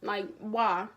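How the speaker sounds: loud, quick retort, imitating an angry grandma; indignant, rapid mock scolding; mad. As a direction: retell her outburst with pushed volume. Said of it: "then she got mad".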